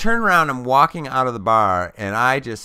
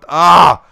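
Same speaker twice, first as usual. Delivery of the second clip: disgusted shriek; 6 semitones higher, 13 dB louder than usual. Retold or imitated. Panic about touching his face.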